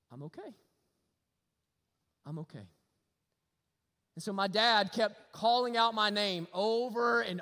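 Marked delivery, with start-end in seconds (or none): 0.1-2.7 soft, low inner thought of relief; quiet relief. Retold or imitated. Lost boy hears his dad and thinks to himself, softly relieved. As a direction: tell yourself softly you're safe. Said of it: "you know what I thought?".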